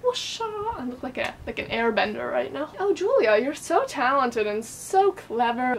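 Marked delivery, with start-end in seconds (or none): 0.0-0.8 whoosha, a high soft swoosh; whoosh sound. Pretend. Mimicking a swing. 2.8-5.8 mock admiring viewer, slowed down; pretend viewer praise. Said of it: "Oh, thank you, viewer".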